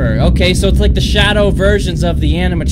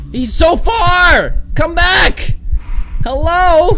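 frustrated yelling; 12 semitones higher, much slower than usual. Real, not acted. Enemy escapes far away.

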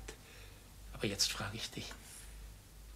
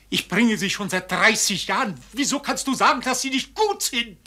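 indignant outburst; 7 semitones higher, 9 dB louder than usual. Acted. Father flares up at his daughter.

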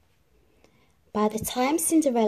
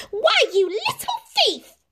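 very high, shrill accusing shriek; shrieking Drizella; 5 dB louder than usual. A narrator's reading. Drizella shrieks at Cinderella over the beads. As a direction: shriek with outrage. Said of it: "she shrieked".

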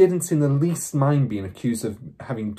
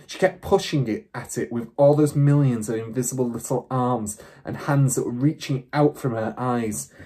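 plain reading voice

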